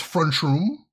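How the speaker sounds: Chicago "front room" run together as "frunchroom"; Chicago accent, T turned to CH. Shown as a demonstration. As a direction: demonstrate Chicago slurring into "frunchroom". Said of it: "in the Chicago accent is pr-, pronounced".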